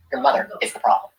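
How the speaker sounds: ordinary talk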